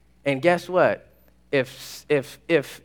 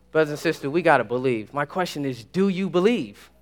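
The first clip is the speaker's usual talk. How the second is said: ordinary talk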